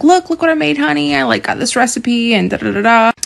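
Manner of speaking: high, eager show-and-tell; bubbly, eager to show off her cooking; high, excited voice showing off a new recipe; excited wife. Retold or imitated. Wife excitedly showing husband dish.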